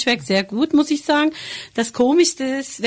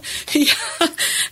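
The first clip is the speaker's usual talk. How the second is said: breathy laugh; 7 semitones higher than usual. Real, not acted. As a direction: chuckle at being called the oldest. Laughs when introduced as longest-serving.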